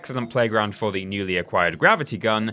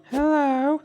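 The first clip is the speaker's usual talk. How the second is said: put-on high sing-song creepy little girl; ghost child. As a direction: breathe a tiny eerie hello. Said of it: "That's my creepy little girl impression".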